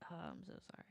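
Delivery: quick whispered sorry; whispered aside after a slip; whispered apology. Real, not acted. Quick whispered self-correction.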